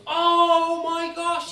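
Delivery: loud, high, drawn-out gasp of horror; acted shock. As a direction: cry it out slowly in exaggerated shock. Gretel hears of devil pact.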